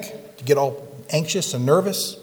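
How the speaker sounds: usual speaking voice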